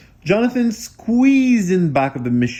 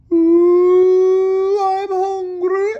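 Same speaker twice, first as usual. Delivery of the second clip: very high, drawn-out hungry wail; high whiny cry; 7 dB louder, much slower than usual. A narrator's reading. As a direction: moan it like a hungry ghost. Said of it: "someone was crying".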